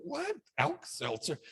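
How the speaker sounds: squeaky high What then a dropped, puzzled Alka-Seltzer; mock baffled young person. Lecturer imitating a confused youngster hearing Alka-Seltzer. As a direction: imitate a young person who never heard of it. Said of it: "I'll bet you the younger people".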